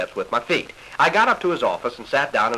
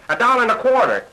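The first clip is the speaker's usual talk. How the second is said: high, louder little farmer piping up; little farmer's bid; 11 semitones higher, 5 dB louder than usual. Retold or imitated. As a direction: squeak the bid out from the back. Little farmer bids at auction.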